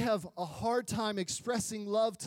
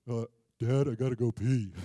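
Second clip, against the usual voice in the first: low-pitched dopey dog voice; deep doggy voice, deadpan request; talking dog; 10 semitones lower than usual. Retold or imitated. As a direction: voice the dog asking Dad, way down low. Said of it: "as if he was saying".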